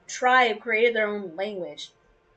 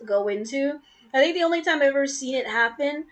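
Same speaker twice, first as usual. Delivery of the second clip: normal talking voice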